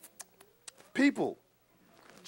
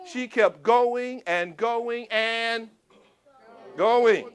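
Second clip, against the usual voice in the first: very slow, stretching each going; drawn out; 3 dB louder, much slower than usual. Acted. Storyteller to kids, stretching going slowly. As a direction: stretch it like it never ends. Stretching Energizer Bunny repeat.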